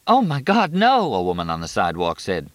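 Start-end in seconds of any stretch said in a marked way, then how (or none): 0.1-1.1 high anguished scream; horrified cry. A narrator's reading. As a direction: scream in horror. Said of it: "She screamed".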